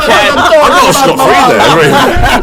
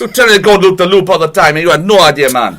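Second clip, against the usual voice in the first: put-on Jamaican accent; 5 semitones lower than usual. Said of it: "Continue this in a Jamaican accent".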